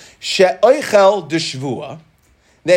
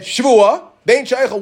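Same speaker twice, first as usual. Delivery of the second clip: emphatic oath; 6 semitones higher, 4 dB louder, slower than usual. Acted. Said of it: "And then he said".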